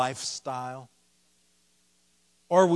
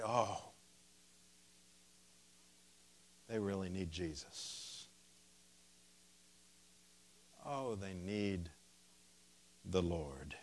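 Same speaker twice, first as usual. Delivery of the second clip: compassionate; 6 semitones lower, 11 dB quieter than usual. Retold or imitated. Compassionate answer to hateful quote.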